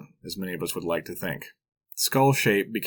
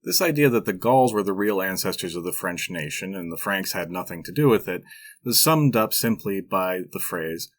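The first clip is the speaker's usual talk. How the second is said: ordinary talk, nothing marked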